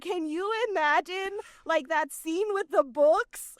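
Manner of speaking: high, amazed retelling of her own reaction; like gushing to a friend about an impressive film scene; excited. Retold or imitated. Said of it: "I was like".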